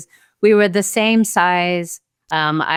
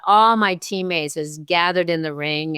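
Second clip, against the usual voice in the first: usual speaking voice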